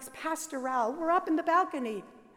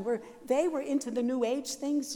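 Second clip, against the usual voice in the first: ordinary talk, nothing marked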